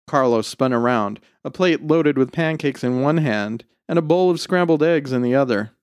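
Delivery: ordinary delivery, nothing marked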